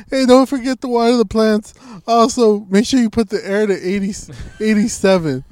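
high, loud mock of dad's instructions; hammed-up bossy dad. Mocking dad's text, high voice.

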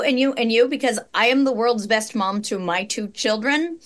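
ordinary talk

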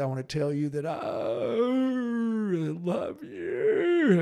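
imitating a father barely getting the words out; broken, tearful voice. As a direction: squeeze out love you through tears. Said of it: "it came out broken 'cause he was full of tears".

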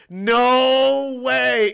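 genuinely surprised, a big jump up in pitch and volume. Real, not acted. Streamer reacts to news of a fan playlist, surprised and loud. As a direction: caught off guard, blurt it out.

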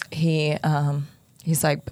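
ordinary talk, nothing marked

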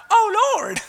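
high-pitched mock cry; wrong, lightweight cry. Shown as a demonstration. Preacher shows how not to cry out, high mock plea. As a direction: pitch it way up as a parody of praying.

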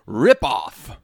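drawn-out punched review title; mock outrage. Reading a one-star review title, mock outrage. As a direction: declaim the title with mock anger.

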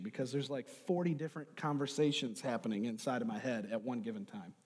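ordinary delivery

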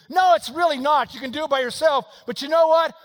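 loud, high, driving preacher delivery; full-force preaching. Acted. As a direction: preach it at a shout, urgent and relentless.

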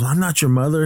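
lightly acted woman's protest; mock protest. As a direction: act out a high, insistent denial. Retold protest to unhinged son.

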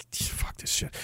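muttered whisper under his breath; exasperated whisper; whispered curse. Real, not acted. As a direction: hiss it to yourself, fed up. Frustrated aside to himself.

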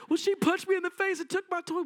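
put-on high, fast, whining child voice tattling; whiny kid. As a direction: play the tattling little sibling.

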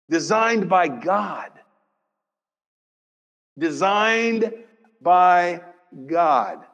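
each word set apart, slow; savouring Designed by God; slow repetition. Real, not acted. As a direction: space the words out deliberately. Preacher repeats slowly.

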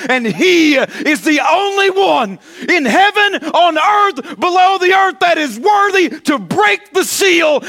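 high, loud shouted praise; full-force preaching. Acted. Preacher shouting praise to Way Church.

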